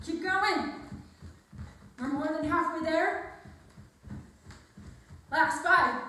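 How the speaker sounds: loud pushing coach cues; shouting. Real, not acted. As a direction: push them hard, loud and high. Coach pushing through last seconds.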